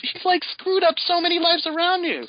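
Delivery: high, exasperated disbelief; indignant rant. Real, not acted. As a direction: pile on the outrage, voice climbing. Ranting about Peter letting villain go.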